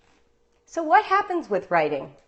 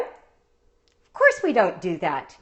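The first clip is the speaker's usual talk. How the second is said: dry irony; 4 semitones lower, slower than usual. Real, not acted. Ironic answer to own question.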